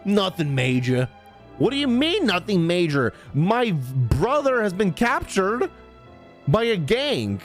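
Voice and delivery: ordinary talk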